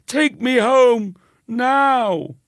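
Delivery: high, loud, slow wail; tearful boy begging to leave; crying. Acted. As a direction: plead through tears. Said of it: "he cried".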